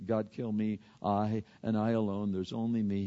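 low, soft, subdued lament; mildly self-pitying. Acted. Elijah alone in his pity party, quietly self-pitying. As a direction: quietly feel sorry for yourself.

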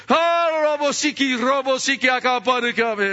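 high, loud ecstatic calling out; mock ecstatic shout in tongues. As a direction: cry out the strange syllables in fervent praise. Woman crying out in tongues.

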